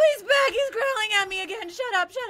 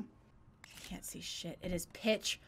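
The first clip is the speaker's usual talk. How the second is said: whisper; 12 semitones lower, 15 dB quieter, slower than usual. Real, not acted. Scared in the dark.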